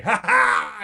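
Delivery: high, loud triumphant laugh-shout; screaming defiance. Retold or imitated. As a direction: yell it into the storm. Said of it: "screaming out at the storm".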